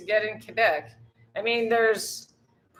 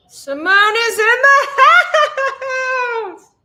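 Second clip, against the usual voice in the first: much higher, louder, slow, laughing shout; calling out; 9 dB louder, much slower than usual. Real, not acted. Welcoming her friend Simone to the stream with a long, high, laughing shout. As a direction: hoot the welcome like an announcer. Greeting viewer Simone arriving.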